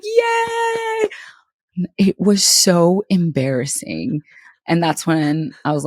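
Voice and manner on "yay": high squeaky cheer of the crowd; mock crowd cheer. Mimicking the clapping crowd cheering her on. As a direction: cheer like the whole bleachers. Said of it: "They were like".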